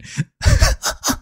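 a very high, airy little giggle; high squeaky giggle. Real, not acted. Laughs at herself.

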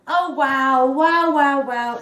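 loud, ringing wows; real thrilled amazement. Real, not acted. Crocheter unwrapping her friend's handmade gift, thrilled amazement. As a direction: let the wows swell loud and high.

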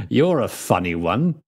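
amused inspector, chuckling first. A narrator's reading. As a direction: open with a chuckle, then tease. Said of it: "chuckled the inspector".

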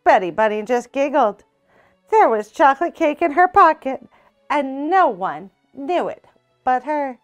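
ordinary delivery

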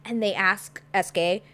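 usual speaking voice

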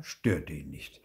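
plain reading voice

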